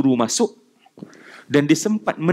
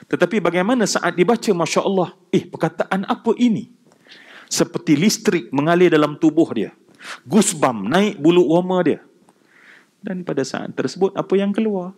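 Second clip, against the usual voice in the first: ordinary talk, nothing marked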